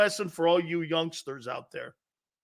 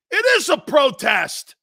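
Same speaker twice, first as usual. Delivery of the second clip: protester shouting; 6 semitones higher, 6 dB louder than usual. Acted.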